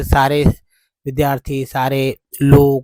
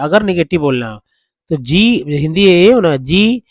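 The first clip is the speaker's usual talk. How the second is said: ordinary delivery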